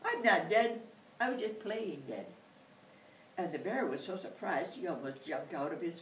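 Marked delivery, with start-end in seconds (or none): 0.0-2.3 calling out. Acted. Joe calling to the bear.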